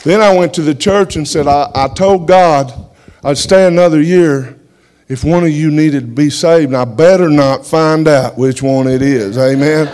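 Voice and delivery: fired-up testimony to the congregation; full-force preaching. Acted. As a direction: preach it to the back row.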